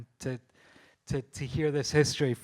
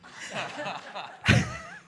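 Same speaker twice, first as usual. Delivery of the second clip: high giggle; 14 semitones higher than usual. Real, not acted. Laughing at Murray's antics.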